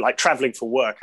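usual speaking voice

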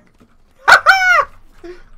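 loud high burst of laughter; excited laugh. Real, not acted. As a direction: laugh out in delight. Laughing after grabbing flag.

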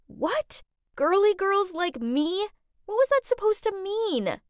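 high, offended disbelief; indignant shout back. A narrator's reading. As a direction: fire back at mom, pitch shooting up. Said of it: "I shouted back".

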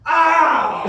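sudden high loud "Ow!"; mock yell of pain. Wife yelping over her taco. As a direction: yelp like you got hurt. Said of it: "she goes".

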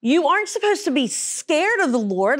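ordinary talk, nothing marked

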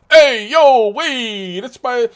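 loud, high New York Italian protest; hammed-up New York Italian wolf defending his buddy; New York Italian accent. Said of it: "speaking like, uh, New York Italian".

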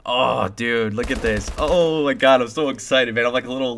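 ordinary talk, nothing marked

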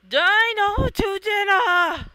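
slow, high, loud chant; rallying shout. Acted. Friends rallying in the fight, loud chant. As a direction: shout the goal like a battle cry.

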